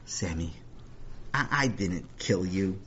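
low, soft, halting protest; hushed, deflated defence; subdued denial. Acted. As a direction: answer slowly and quietly, stumbling a little. Acted brother's subdued denial.

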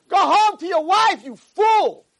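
high, slow, hammered-out yelling; acted indignant tirade; furious scolding. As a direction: berate him like an outraged rabbi. Rabbi dismisses the fool.

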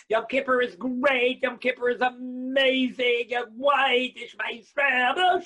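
loud, high, slow triumphant exclaiming; hammed-up elation; elated cry. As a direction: proclaim it like you just made it. Acting out elated post-fast joy.

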